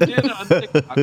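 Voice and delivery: laughing through the words. Real, not acted. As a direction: giggle while talking. Laughing at her own slip.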